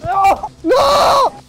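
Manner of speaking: high panicked shouts; frantic yelling. Real, not acted. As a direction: yell out in frantic alarm. Frantic yelling during record attempt.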